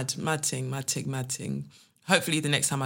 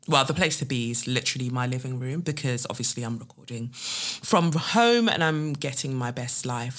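ordinary delivery

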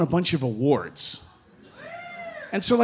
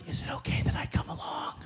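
whispered, shy request; timid whisper; 13 dB quieter, faster than usual. Retold or imitated. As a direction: ask in a tiny whisper, afraid of a no. Timid ask to join them.

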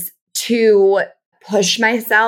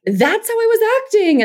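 pitched up, overly pleasant; high sweet act; 14 semitones higher than usual. Retold or imitated. Imitating her own people-pleasing phone act, in a high voice. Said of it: "more severe, like".

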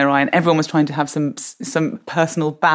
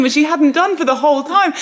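ordinary talk

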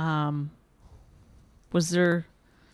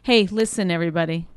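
ordinary talk

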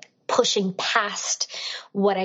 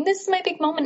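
dreamy excitement; 10 semitones higher, much faster than usual. Retold or imitated. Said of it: "And I'm thinking".

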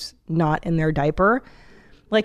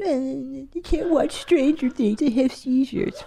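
a lightly voiced, higher, softer whine, mocking the crybabies; mocking whiny complainer; 6 semitones higher, 3 dB quieter, slower than usual. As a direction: sneer it like a fussy complainer who's afraid of everything.

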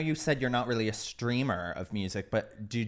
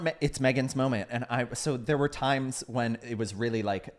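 normal talking voice

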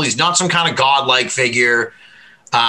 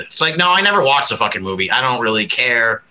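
usual speaking voice

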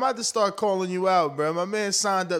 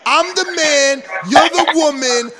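higher, louder mock macho declaration; bossy man of the house; 7 semitones higher, 8 dB louder, slower than usual. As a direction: lay down the law, hammed up. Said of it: "tell your girl".

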